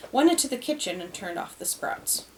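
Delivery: ordinary delivery, nothing marked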